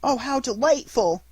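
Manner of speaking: high, loud cry of delight; joyful shout. Acted. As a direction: shout it jumping for joy. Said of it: "shouted Pinocchio, jumping for joy".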